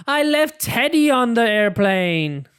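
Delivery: high, loud panicky wail; high upset child; alarmed cry. Acted. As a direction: cry out in dismay over the lost bear. Peppa realises she lost Teddy.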